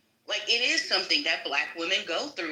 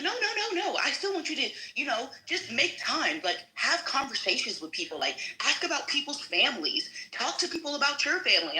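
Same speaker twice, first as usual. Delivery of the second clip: higher-pitched imitation of a placating boss; backpedalling sergeant; 7 semitones higher than usual. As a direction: mimic a supervisor hastily softening criticism, pitched up.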